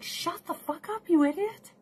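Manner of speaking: high, scornful put-down; scornful mock rant, pitched high; mock retort. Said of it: "I'm like".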